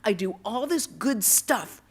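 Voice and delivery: loud, outraged tirade; retold angry scream. Betrayed boy rages at God, retold angry scream. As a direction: hurl the accusations, building to the broken-off curse. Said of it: "I screamed at him".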